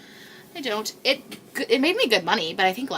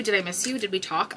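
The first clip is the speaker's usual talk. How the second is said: ordinary talk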